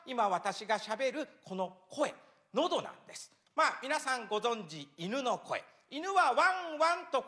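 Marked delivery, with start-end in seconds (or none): none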